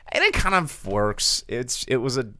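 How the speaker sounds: ordinary delivery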